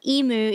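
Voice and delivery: emu said as "ee-moo"; mock the wrong "ee-moo" emu; wrong pronunciation. Shown as a demonstration. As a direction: EMU with no y-glide. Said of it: "It's not emu".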